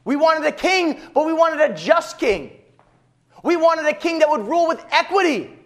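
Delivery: high, pleading complaint of let-down subjects; aggrieved people. Retold or imitated. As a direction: protest that the king betrayed them. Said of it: "And they say".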